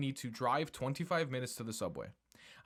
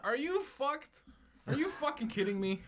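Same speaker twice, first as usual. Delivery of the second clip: outraged disbelief; 14 semitones higher than usual. Real, not acted. Host stunned by the Mario voice, high outraged disbelief.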